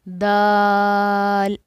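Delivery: दाल held very long and loud, three counts; drawn out. Shown as a demonstration. Teacher showing students the three-count long दाल. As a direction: stretch दाल out long like recitation. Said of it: "अब दाल को हम लंबा करेंगे".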